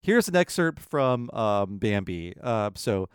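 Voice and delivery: ordinary talk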